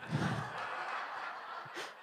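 breathy nervous laugh before speaking. Real, not acted. New speaker at the microphone, breathy nervous laugh. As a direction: laugh off the nerves. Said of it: "just gotta get it out of the way".

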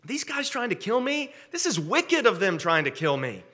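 high-pitched, agitated appeal to God; David. Acted. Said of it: "what David is saying is".

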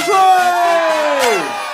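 huge, high, drawn-out whoop; surprised shout. Acted. Father spotting his lost son, surprised shout. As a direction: shout out in amazement, very high and loud.

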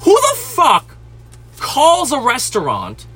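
very high opening, dragged out; indignant outburst. Real, not acted. Venting about callers without manners, indignant outburst. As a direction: burst out high, then stretch each word.

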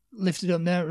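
ordinary delivery

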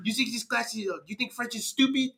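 high-pitched mock French indignation; hammed-up offended French teacher; French accent. Teacher asking student if French is stupid, French accent. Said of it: "I'm gonna do the French voice for her".

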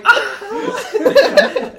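high, loud yelp of shock; startled yell. Retold or imitated. Storyteller reenacts walking in on naked friend, startled yell. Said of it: "I was just yelling".

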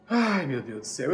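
sighed out, low and subdued; exasperated sigh. Retold or imitated. Student reacting to the professor's correction, retold sigh. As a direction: sigh the words in weary disbelief. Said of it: "Eu suspirei e falei assim".

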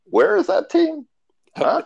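high, baffled voice; baffled surprise. Retold or imitated. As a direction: sound baffled where the team is.

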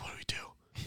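retold hushed whisper; scared whisper. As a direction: whisper it, acting out hiding from a robber. Hiding from supposed robber, acted.

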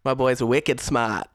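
slow, drawn-out mock Boston accent. Riffing on Boston accent joke.

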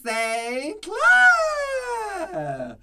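slow, high, drawn-out Southern belle; a grand Southern lady declaring the name, x0.3 slow; Southern belle drawl. Acted. Host greets Blair Saint Clair, in a slow Southern belle drawl. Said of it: "Oh, I do".

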